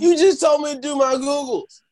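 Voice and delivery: high-pitched, playful protest; mock protest. Real, not acted. Mock protest at being teased.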